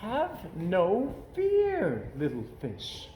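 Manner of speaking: sly relaxed cat; calm Cat. A narrator's reading. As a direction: soothe the fish lazily. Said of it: "said the Cat in the Hat".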